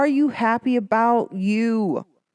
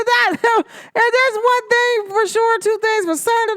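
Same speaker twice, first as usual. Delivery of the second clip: laughing; 13 semitones higher, 4 dB louder, slower than usual. Real, not acted. Laughing at the idea.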